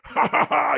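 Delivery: high, loud mocking laugh in a put-on voice; pirate-style taunting laugh; mocking cackle. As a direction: cackle at them like a madman. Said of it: "and goes".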